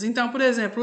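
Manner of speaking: ordinary talk, nothing marked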